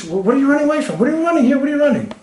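high, sly fox calling to the fish. Retold or imitated. Fox teases the fish in the river, high sly voice. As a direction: play the cunning fox, pitched well up and teasing. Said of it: "So the fox said to the fish".

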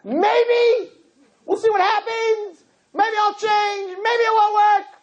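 very high, slow shrugging maybe; mock noncommittal boy. Rabbi mocking a noncommittal boyfriend, very high. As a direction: lampoon a wishy-washy answer. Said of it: "Did you tell her".

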